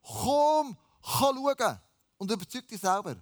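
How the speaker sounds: high, emphatic invitation; calling out. A narrator's reading. Philippus answers Nathanael's doubt, calling out. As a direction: call them over with a high, punched first word.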